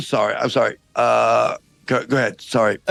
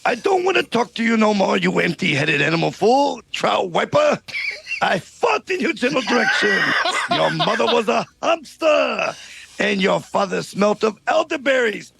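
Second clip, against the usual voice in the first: put-on French accent. French guard taunting King Arthur's knights, hammed-up French accent. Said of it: "this outrageous accent".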